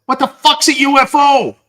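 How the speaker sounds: high, loud mock bellow; top of his voice. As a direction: bellow it so the whole restaurant hears. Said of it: "at the top of his voice".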